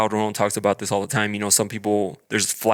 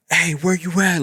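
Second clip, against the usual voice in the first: raised, high-pitched yell held back for retelling; retold yelling; 7 semitones higher, 3 dB louder than usual. Caller yelling at the Uber so others hear, mock yell. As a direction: call out impatiently, reenacting a phone yell. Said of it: "I'm yelling at him".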